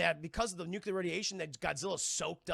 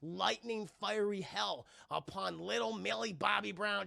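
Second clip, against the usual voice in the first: usual speaking voice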